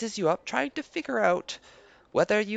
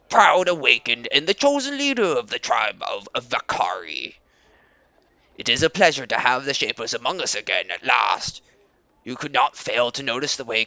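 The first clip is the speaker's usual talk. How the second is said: Leader Koba, proud; 9 semitones lower, 5 dB louder than usual. Acted. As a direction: greet guests with stiff pride. Koba speaks as NPC.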